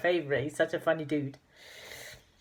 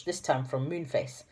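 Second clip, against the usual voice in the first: ordinary delivery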